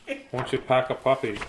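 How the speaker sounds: plain reading voice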